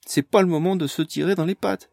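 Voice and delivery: high, loud urgent cry. A narrator's reading. Amélie cries out to stop the fighting. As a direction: shout to stop the quarrel. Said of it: "s'écria Amélie".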